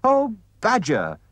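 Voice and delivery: high, slow pleading call; high, slow eager Rat voice; Rat crying out. A narrator's reading. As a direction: call out to Badger at the door. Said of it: "cried the Rat".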